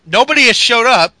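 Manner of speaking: ordinary talk, nothing marked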